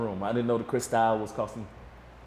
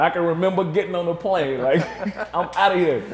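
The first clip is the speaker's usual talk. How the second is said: laughing; 6 semitones higher, 6 dB louder than usual. Real, not acted. Laughing recalling fleeing.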